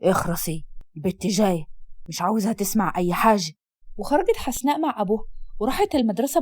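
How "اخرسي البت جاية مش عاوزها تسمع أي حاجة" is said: low, hushed command; husband silencing his wife as the girl comes; hushing father. Acted. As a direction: cut her off low.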